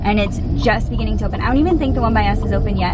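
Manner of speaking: rushed, quick chatter; fast talking. Real, not acted. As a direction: rattle it off at speed. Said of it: "I'm talking like a mile a minute".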